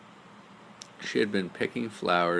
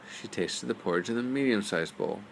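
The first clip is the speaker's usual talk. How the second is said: ordinary delivery, nothing marked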